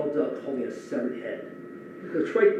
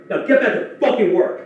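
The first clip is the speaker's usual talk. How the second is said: loud, higher barked order; sudden shout; 8 semitones higher, 9 dB louder than usual. Retold or imitated. Boss ordering the crying worker back, sudden shout. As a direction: flip from hush to a yell. Boss snaps after whisper.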